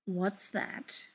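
quiet and even; calm stern question; level, stern. A narrator's reading. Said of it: "he says levelly".

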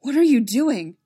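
captain horrified at the order, loud; shocked. Acted. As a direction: recoil in shock and horror. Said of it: "eyes wide with shock and horror".

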